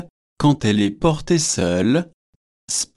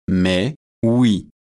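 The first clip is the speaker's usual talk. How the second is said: lower, solemn and serious yes; grave tone; 4 semitones lower than usual. A narrator's reading. Batman gravely confirms his coat over the cassock, in a grave tone. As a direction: answer gravely, as if it were perfectly natural. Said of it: "répondit Batman d'un ton grave".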